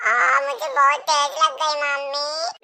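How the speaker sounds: slow, low, drawn-out wail; hurt child whining. Acted. Hurt child complaining to mother, slow wailing.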